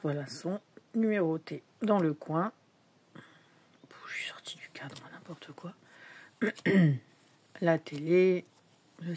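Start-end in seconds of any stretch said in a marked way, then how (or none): 3.9-5.7 muttered whisper to herself; whispered aside. Real, not acted. Vlogger noticing she left the camera frame, whispering. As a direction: hush it as a self-mocking aside.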